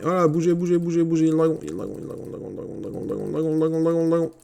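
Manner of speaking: rapid, frantic repeated chant; urgent gabble, the same words over and over; panicked warning. Real, not acted. Player warning his troops of the dragon, panicked babble.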